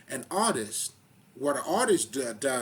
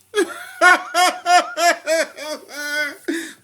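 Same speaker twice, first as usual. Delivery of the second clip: loud laugh; 14 dB louder than usual. Real, not acted. Laughs at own mock question.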